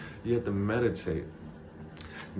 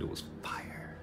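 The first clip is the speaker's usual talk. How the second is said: whisper; 13 dB quieter than usual. Real, not acted. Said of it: "whispered aside".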